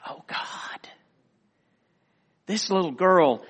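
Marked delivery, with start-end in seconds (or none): 0.1-0.9 hushed, whispered reaction; awed whisper. Retold or imitated. Pastor moved by a little girl's prayer, whispering. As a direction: breathe it out in reverent wonder.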